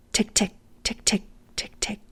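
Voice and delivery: soft clicking tick of windshield wipers. Acted. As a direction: tick it out softly like wipers on dry glass. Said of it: "great sound effects".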